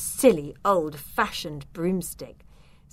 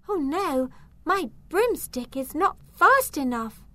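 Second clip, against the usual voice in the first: high-pitched, anxious panic; panicked; 9 semitones higher than usual. A narrator's reading. Zelda chased down the tunnel by the dragon, panicking. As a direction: fret aloud in a high voice. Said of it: "said Zelda in a panic".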